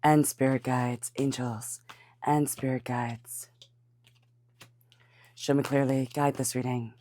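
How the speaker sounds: slow, soft chant to the guides; prayerful invocation. Acted. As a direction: call on the angels softly. Invocation while shuffling.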